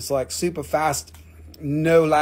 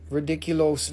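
usual speaking voice

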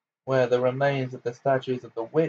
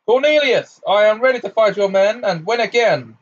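much louder, higher booming declaration; thunderous voice; 9 semitones higher, 8 dB louder than usual. Acted. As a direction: thunder it at him. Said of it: "in a thunderous voice".

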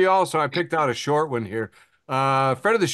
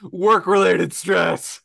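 high, loud joyful hooray, then slowing down on the last phrase; overjoyed cheer; 6 semitones higher, 6 dB louder, much slower than usual. Retold or imitated. Meme woman cheering the Borg arrival, overjoyed. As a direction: cheer like a woman welcoming doom. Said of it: "this woman's got her arms out going".

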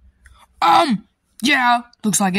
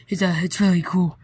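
ordinary talk

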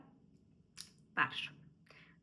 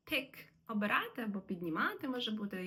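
ordinary talk, nothing marked

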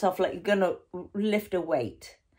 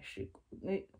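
quiet distant voice; 11 dB quieter than usual. Shown as a demonstration. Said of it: "Don't do the quiet voice".

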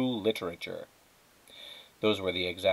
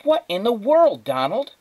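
much higher, loud alarmed yell; yelling over noise; 12 semitones higher, 7 dB louder than usual. Acted. Wife yelling at Donald over the roaring vacuum. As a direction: shout over a roaring machine.

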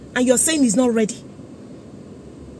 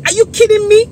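loud and high, can't believe it; incredulous outburst; 7 semitones higher, 6 dB louder than usual. Real, not acted. Voter fed up with the electoral office, incredulous loud outburst. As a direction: blurt it out in real disbelief. Venting at unready voter cards.

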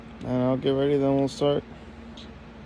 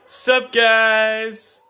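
shouted, high greeting to camera; loud greeting; 8 semitones higher, 8 dB louder than usual. Real, not acted. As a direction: call out to the viewers.

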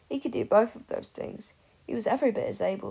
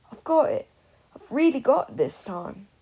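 higher and louder, thrilled with himself; excited outburst; 5 semitones higher, 7 dB louder than usual. Acted. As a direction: burst out with a triumphant discovery. Peter bursts out with his idea.

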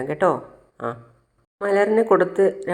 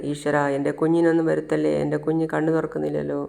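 usual speaking voice

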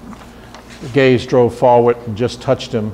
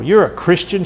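higher-pitched, accusing jab; mild hiss; 6 semitones higher than usual. A narrator's reading. Woman confronts the Bible salesman, mildly hissing. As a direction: accuse him with a touch of venom. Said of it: "she hissed".

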